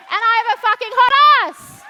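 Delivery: loud, fast, high proud shout; bold declaration. Retold or imitated. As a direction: proclaim it loudly and shamelessly. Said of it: "and you're like".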